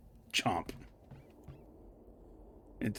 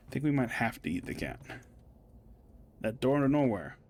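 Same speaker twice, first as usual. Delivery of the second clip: ordinary talk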